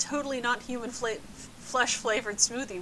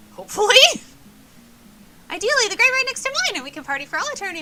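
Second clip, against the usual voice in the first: giddy shout; 10 semitones higher, 11 dB louder, faster than usual. Acted. Giddy ghost girl shouting.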